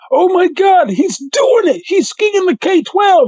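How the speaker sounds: high-pitched, amazed hollering; imitated movie yell. Spectator yells about the one-ski run, high imitated yell. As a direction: call it out high like a stunned spectator. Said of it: "the guy yells".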